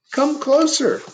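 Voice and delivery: high inviting call; the dark draws Laszlo near; the dark beckoning. Acted. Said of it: "said the dark".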